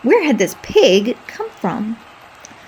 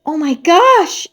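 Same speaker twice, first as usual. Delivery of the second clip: higher, louder, breathless gasp of joy; excited fan squeals at the belt, higher and louder; thrilled fan; 8 semitones higher, 5 dB louder, slower than usual. Acted. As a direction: swoon over it. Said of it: "nearly fainting with excitement".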